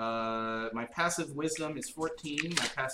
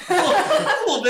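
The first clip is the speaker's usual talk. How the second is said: laughing; 12 dB louder, slower than usual. Real, not acted. Laughing at the joke.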